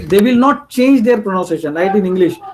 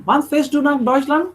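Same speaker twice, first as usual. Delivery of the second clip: "Wann fährst du nach Deutschland" in one connected run, higher, rising; fluent; 5 semitones higher than usual. Shown as a demonstration. Teacher showing fluent German, a smooth rising question. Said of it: "you have to speak very fluently".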